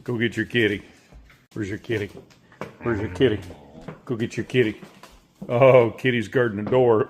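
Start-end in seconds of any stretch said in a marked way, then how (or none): none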